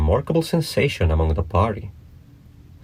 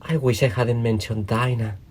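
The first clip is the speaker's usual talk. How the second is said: melancholy; 5 semitones higher than usual. A narrator's reading. Alice talks to herself once left alone, mildly melancholy. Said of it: "in a melancholy".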